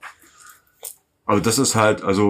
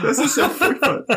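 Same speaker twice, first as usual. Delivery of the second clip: shrill, laughing mock outrage; high mock horror. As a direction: squeal it in fake horror. Mock horrified outcry.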